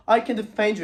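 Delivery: loud, high-pitched eager offer; insistent mock boast. Kid offers to protect Little Red, loud and insistent. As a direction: imitate an over-keen protector, loudly.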